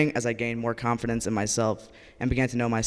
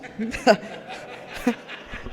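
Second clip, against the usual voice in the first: breathy silent laugh; 10 semitones higher than usual. Real, not acted. Laughing at bathroom joke.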